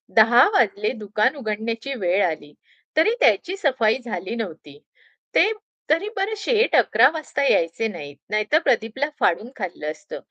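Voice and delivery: plain reading voice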